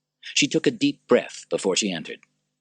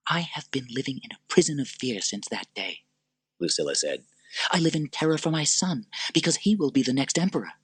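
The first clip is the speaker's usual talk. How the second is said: fearful Lucilla; 8 semitones higher than usual. Acted.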